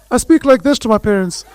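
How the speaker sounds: very high-pitched, bass-free voice; zero-bass voice. Shown as a demonstration. Host shows how he talks to his parents, high zero-bass voice. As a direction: lift the voice right up, all the bass gone. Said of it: "Zero bass in my voice".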